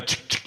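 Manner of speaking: imitated racking shotgun; two quick mouth-made clicks; shotgun pump noise. Buyer asking for a shotgun, pump noise.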